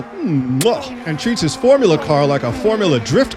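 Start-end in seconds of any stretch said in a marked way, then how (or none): 0.0-0.9 exaggerated "mwah" kiss noise; mock kiss smack. Commentator mimics the car kissing the wall, mock kiss.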